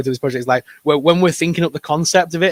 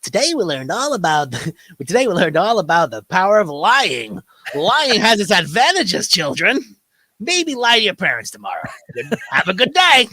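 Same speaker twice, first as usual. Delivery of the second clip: cartoon moral ending; 6 semitones higher than usual. Spoofing a cartoon show's moral for the kids, in a high preachy voice. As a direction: sign off the episode with a cheesy lesson, pitched up. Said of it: "dodgy moral endings".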